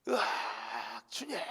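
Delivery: slow, high cry while falling; a hammed-up plunging cry; mock falling scream. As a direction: play the terrified fall. Man falling off a cliff.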